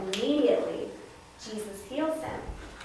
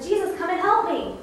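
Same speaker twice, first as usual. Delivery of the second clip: high, louder imitated cry for help; calling out; 8 semitones higher, 5 dB louder than usual. Blind Bartimaeus keeps begging Jesus, calling out. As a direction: call out to be heard over a crowd. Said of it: "He kept on saying that".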